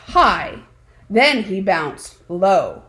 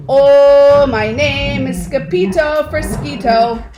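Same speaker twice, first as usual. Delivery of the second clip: sung-out hammed-up Spanish accent, higher and loud on Oh; mock Spanish accent; 4 semitones higher, 7 dB louder than usual. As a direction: croon it like a caped hero in a Spanish accent. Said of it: "using his very best Spanish accent".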